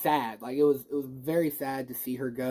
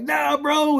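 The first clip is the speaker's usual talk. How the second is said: much higher, louder, breezy "Nah, bro"; cocky Andrew's Peter; 11 semitones higher, 6 dB louder than usual. Retold or imitated. Andrew's Peter brushing off the danger, in a high breezy voice. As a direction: toss it off like a cool hero mid-rescue. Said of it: "Andrew's Peter was like".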